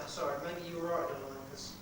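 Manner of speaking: ordinary talk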